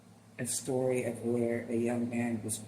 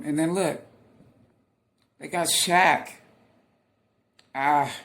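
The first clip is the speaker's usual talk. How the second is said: loud, higher-pitched delighted outburst; thrilled; 4 semitones higher, 9 dB louder than usual. Real, not acted. Reacting to police bringing Shaq along, loud and thrilled. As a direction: exclaim it loudly, thrilled and moved. Thrilled by celebrity turning up in clip.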